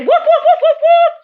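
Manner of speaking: loud, high-pitched imitation woofs; string of mock doggy yelps; dog barking. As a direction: bark like an excited dog, very high. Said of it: "I'm Doggy".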